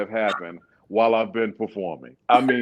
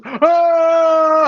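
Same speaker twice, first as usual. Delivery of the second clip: high, loud falling-off-stage scream; long "ahh" as if plunging in the dark; imitated scream; 9 dB louder than usual. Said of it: "all you heard was".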